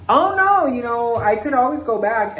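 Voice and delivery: very high, loud mock-polite squeal; hammed-up high sweet-girl voice; fake sweet reply. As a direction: sound falsely cheerful and accommodating. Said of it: "I'm like".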